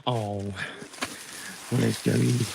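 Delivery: normal talking voice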